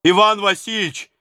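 put-on loud pleading cry of an old servant; old servant falling on his knees, crying out; sudden cry. A narrator's reading. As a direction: cry out to the tsar in desperation. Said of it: "воскликнул он вдруг".

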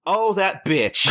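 high, drawn-out mock indignation; mock resentment. Hostess watching her rival greet customers, mock-resentful. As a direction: draw it out as a catty imitation.

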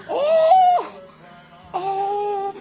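loud, high gasp of surprise; big astonished oh; surprised. Acted. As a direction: shriek with astonishment at the new hair. Aunt sees the new haircut.

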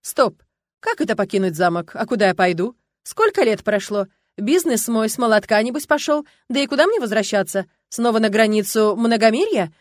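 loud, agitated volley of questions; flustered. A narrator's reading. Youth faces eviction from the castle, flustered. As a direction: protest in a rising panic. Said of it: "переполошился парень и замахал руками".

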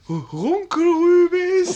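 hoch, laut und langsam; lauter Ausbruch. Acted. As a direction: die Rübe herausschreien. Betrunkener Ausbruch. (betrunken laut gedehnt)

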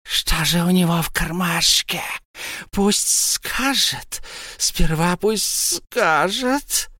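high, very slow hissing demand; shrill hissing Gollum. A narrator's reading. Gollum hisses at Bilbo to say what's in his pocket. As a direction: hiss the demand. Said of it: "пронзительное шипение".

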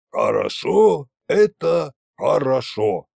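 slow, mock-wise drunken voice; drunk sage tsar. A narrator's reading. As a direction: drag it out like a tipsy philosopher. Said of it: "мудро заметил Кощей". (медленно и мудро, спьяну)

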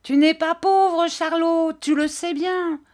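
put-on very high, taunting boys' chant; crying street boys. A narrator's reading. Neighbourhood boys invade the shop demanding gold, high taunting cries. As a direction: call out high, pestering the old man. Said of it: "en lui criant".

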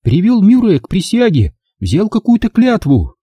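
put-on high, bitter girl's voice; bitter Angela. A narrator's reading. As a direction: complain bitterly about him. Angela continues. (Анжела с горечью, высоким голосом)

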